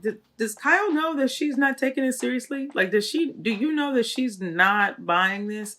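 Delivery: incredulous question. Retold or imitated. Viewer reacting to Kyle's scripted visit, incredulous. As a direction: ask it high, baffled at her cluelessness.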